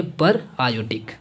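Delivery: पर आयोडिक with पर set apart; two separate words. Shown as a demonstration. Teacher pointing to the written name, पर आयोडिक read as two words. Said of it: "पर आयोडिक पढ़ना है".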